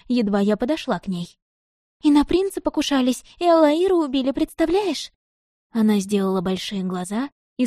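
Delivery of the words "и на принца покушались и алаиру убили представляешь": put-on high, excited girlish chattering. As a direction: gush the gossip in a rush. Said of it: "затараторила Маруня".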